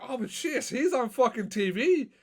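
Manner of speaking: high-pitched, drawn-out mock astonishment; imitated amazed family. Host imagining the family at home seeing him on TV, amazed.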